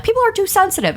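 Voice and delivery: high, whiny mock of commenters. Mocking commenters in her DMs with a whiny voice. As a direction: pitch it up and whine the complaint. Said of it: "people in my DMs who are like".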